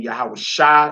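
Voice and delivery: Yahweh Shai ending in open SHA; correct pronunciation. Shown as a demonstration. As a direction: say Shai as sha, no eye glide. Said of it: "Brothers should be saying".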